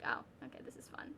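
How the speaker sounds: very soft, muted aside to yourself; understated mock reaction. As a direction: say it under your breath, unimpressed. Deadpan aside mimicking own reaction.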